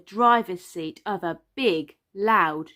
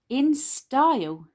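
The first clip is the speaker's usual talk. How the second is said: higher, slow and stretched out; showy cry; 6 semitones higher, 4 dB louder, slower than usual. A narrator's reading. Daddy Pig showing how to shop, showy cry. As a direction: announce it with a slow flourish. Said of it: "he cried".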